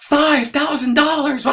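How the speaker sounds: very high, loud, drawn-out shriek; outraged high-pitched mock yell, stretched out; mock yelling wife. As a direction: scream it back in hammed-up disbelief. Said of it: "She starts yelling at me".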